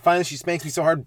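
usual speaking voice

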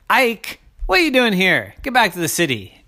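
high, loud shooing yell; hawks yelling. Acted. Hawks yelling at the crow to leave. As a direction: shout him off the bluff.

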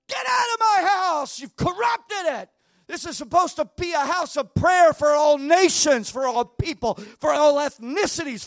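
Jesus screaming them out of the temple. Retold or imitated. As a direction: roar it at the money changers. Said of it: "He's screaming".

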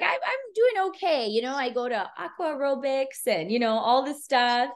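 lightly voiced sassy old woman; older client voice. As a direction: play the spunky seventy-seven-year-old. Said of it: "She's like".

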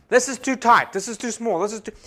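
loud, high mock whining; complaining New Yorker. Mimicking women at Dixie Stampede, loud complaining. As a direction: nag about the tight seats. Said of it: "they start complaining".

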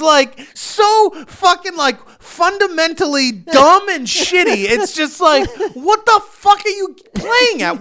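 high-pitched incredulous outburst, leaning on key words; comic outrage building through pointed questions; exasperated rant. Real, not acted. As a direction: rant in disbelief, voice pushed up high.

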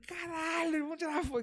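high, soft falsetto squeal; mock fan excitement. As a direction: play a thrilled fan in a small high voice. Acts out excited fan asking for photo.

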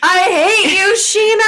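high, loud mock fury; mock outrage. Friend mock-yelling at Sheena over a spoiler, high and loud. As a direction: pretend to hate her, loud and high. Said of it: "And I was like".

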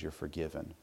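low, very soft reassurance; hushed, calm forgiveness; gentle absolution. Retold or imitated. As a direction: pardon them quietly. God's gentle answer voiced.

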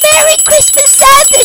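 high excited call up to Santa; she shouts. Acted. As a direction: call up to the sky, high and bright. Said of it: "she shouts".

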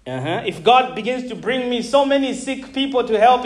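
usual speaking voice

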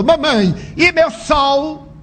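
put-on high, slow child's voice calling to his mother; a young son calling for his mother, high and drawn out; little boy calling out. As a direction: call out high like a small boy. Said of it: "Mark gritou".

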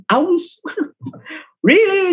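high incredulous disbelief. Real, not acted. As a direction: react as if the cup is absurd. Incredulous at misspelled cup.

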